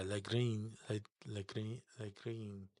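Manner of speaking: "Le Green" as "leh-GREEN", softly repeated; practising a hard name. Shown as a demonstration. Fan still practising a player's name, "Le Green" softly. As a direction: try "Le Green" quietly, with short "leh" and long "green". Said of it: "I still, to this day".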